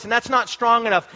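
ordinary talk

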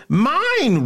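high, loud indignant yell; indignant roar. Acted. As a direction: bellow it, offended. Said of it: "roared Bunter indignantly".

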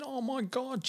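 very high, overcome disbelief; imitated laughing and crying. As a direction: act out being overwhelmed on the phone. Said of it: "And she's laughing and crying".

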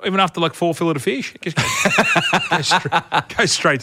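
high, laughing while talking; giggling through the punchline; cracking up at the tale. Real, not acted.

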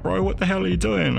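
high-pitched, urgent disbelief; alarmed protest. Retold or imitated. Mate struggling with him in the lecture, alarmed protest. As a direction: pitch it up, trying to stop a friend.

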